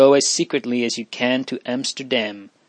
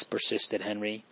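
ordinary delivery, nothing marked

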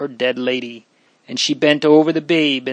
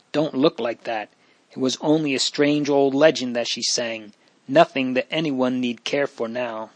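ordinary delivery, nothing marked

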